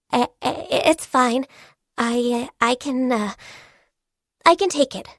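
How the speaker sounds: halting, nervous false starts; hesitant, trying to sound brave; flustered stammer. Acted. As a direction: stammer while bracing for bad news. Braces for an unwelcome verdict.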